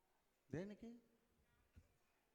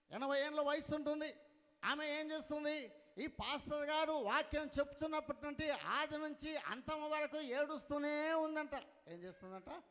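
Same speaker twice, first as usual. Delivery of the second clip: raised, emphatic sermon storytelling; preaching; 7 semitones higher, 9 dB louder than usual. Real, not acted. Preacher tells story at full force.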